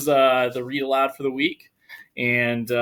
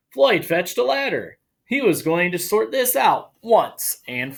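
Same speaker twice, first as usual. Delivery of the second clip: lively, higher read-aloud voice; animated storytime narration; story reading; 7 semitones higher, 3 dB louder, slower than usual. Acted. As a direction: read it like a children's picture book. Reads picture book aloud.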